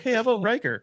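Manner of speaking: chirpy suggestion. Retold or imitated. Guest pitching Riker to host, mock eager. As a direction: sell the idea eagerly. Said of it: "I'd be like".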